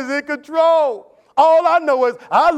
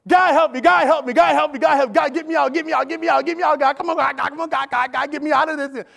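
pleading cry; 4 semitones higher than usual. Retold or imitated. Israelites begging God.

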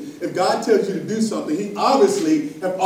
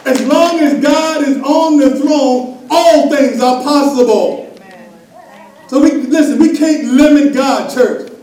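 loud, high preaching push; full-force preaching; 8 semitones higher, 10 dB louder than usual. Acted. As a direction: drive it home to the church.

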